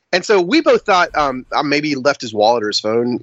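ordinary talk, nothing marked

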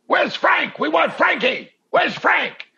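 high, loud heckling chant; front-row fans yelling for Frank, high and loud; crowd yelling. Retold or imitated. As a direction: bellow for Frank like a rowdy fan. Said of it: "people in the front row going".

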